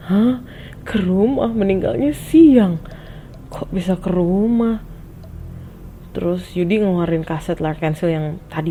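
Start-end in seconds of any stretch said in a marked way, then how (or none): 0.1-4.8 higher, slowed disbelieving questions; kaget. Retold or imitated. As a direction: question it in startled puzzlement. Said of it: "gue kaget kan". (bertanya kaget dan heran)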